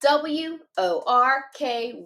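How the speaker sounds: letters spaced out slowly; slow spelling. Shown as a demonstration. Teacher spelling a tricky word slowly for students. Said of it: "Let's do it again".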